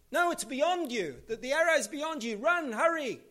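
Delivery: high, loud call across the field. Retold or imitated. Jonathan calls the arrow signal to the boy, loud call. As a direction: shout it to the boy far off.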